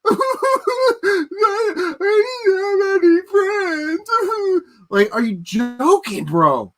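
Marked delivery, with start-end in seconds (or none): 0.1-0.2 high, slow, blubbering mock voice; mock crying whiner. Mocking a grown man crying online, high blubbering voice. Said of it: "on the internet crying". 0.2-2.4 high fake wail; mock sob. Mocking crybaby YouTuber, high fake wail. 2.4-4.1 high, slow, blubbering mock voice; mock crying whiner. Mocking a grown man crying online, high blubbering voice. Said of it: "on the internet crying".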